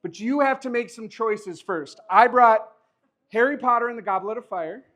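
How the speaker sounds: ordinary talk, nothing marked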